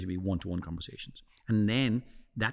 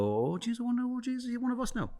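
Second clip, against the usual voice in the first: high, wondering mock voice; mock wary onlooker; 13 semitones higher than usual. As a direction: squeak it like a suspicious local. Audience reacting to a stranger.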